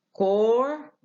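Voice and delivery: كور with no p or s; silent ps. Shown as a demonstration. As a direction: say كور, corps as core. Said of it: "بدل".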